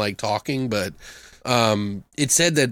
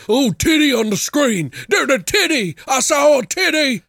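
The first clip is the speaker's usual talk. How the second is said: high, loud, gushing mock voice; squealing mockery of easily thrilled viewers; mocking excited gawker; 12 semitones higher, 4 dB louder than usual. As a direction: ham up an overexcited guy spotting nudity. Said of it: "that's why when people say".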